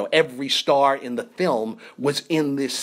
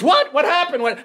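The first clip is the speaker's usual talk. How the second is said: high, alarmed questioning; retold burst of group confusion; startled crowd; 12 semitones higher, 4 dB louder than usual. Acting crowd's startled reaction.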